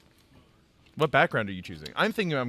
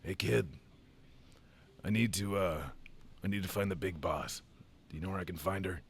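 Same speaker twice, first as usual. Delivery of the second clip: gruff mercenary; 6 semitones lower, 9 dB quieter than usual. Acted. Roleplays a mercenary asking kid.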